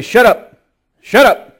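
high, loud demonic snarl of shut up; demon voices. Retold or imitated. Pastor retelling demons silencing the men, snarling. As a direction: snap it like a hostile demon. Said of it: "they say".

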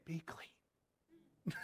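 barely audible, hesitant whisper; tiny hushed voice, no force; timid whisper. Acted. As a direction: whisper it as a mock-feeble command. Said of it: "He wasn't saying".

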